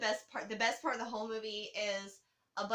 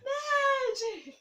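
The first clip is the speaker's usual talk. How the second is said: much higher, louder, laughing through the word; laughing catchphrase; 7 dB louder than usual. Acted. Repeating his stage catchphrase to her co-host, laughing high squeal. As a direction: squeal it out as you crack up.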